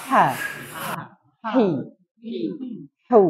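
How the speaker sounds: each letter pure and clean, no extra sound; teacher's clean letter demo; clean Arabic letters. Shown as a demonstration. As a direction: say ha, hi, hu crisply and cleanly. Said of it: "Tirukan sekali lagi".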